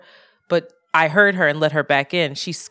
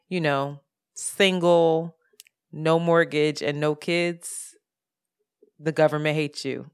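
ordinary talk, nothing marked

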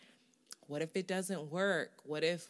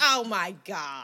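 drawn-out, louder mock-mom exclamation; imitating mom; 6 dB louder, slower than usual. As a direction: stretch it out like an appalled mom. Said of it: "That's just how her mom sounds".

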